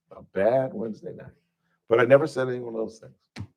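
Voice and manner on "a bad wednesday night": "A bad Wednesday night" repeated lower and soft; quiet rueful echo. Real, not acted. Comedian quietly repeating the night of his arrest.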